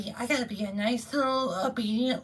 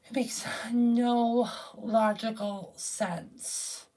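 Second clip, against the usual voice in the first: slow, breathy repeated whisper; exasperated whisper to himself; 3 semitones lower, much slower than usual. Real, not acted. Repeats line, then whispers it.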